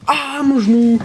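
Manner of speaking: aïe, genou cogné, voix qui monte; s'exclamer de douleur soudaine; cri de douleur. Real, not acted. Se cogne le genou en entrant. (petit cri de douleur)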